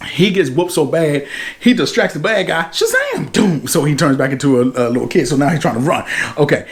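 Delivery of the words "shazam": very high, drawn-out cry; magic word call. Retold or imitated. Billy calling out his magic word, very high and drawn out.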